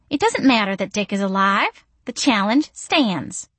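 sweet high-pitched coo. A narrator's reading. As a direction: coo it to charm them. Missy's voice continues.

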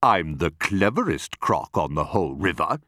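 self-satisfied crocodile; smug enormous crocodile. Acted. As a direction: answer the insult with calm pride. Crocodile retorts with smug pride.

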